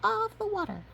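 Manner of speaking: very high squeaky chick; tiny chick voice. Acted. Chicks answering the ducklings, very high and squeaky. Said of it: "they reply".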